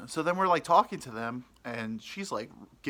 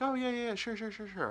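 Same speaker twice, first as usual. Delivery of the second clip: much higher, rushed fake enthusiasm; over-eager yes; 11 semitones higher than usual. Brushing off her lunch invite with fake eager yeses. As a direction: gush agreement you don't mean.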